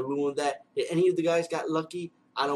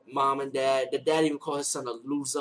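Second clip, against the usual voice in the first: normal talking voice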